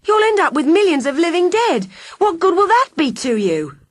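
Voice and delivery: high, loud, alarmed question; girl horrified at the villain's plan. Acted. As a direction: recoil in horror.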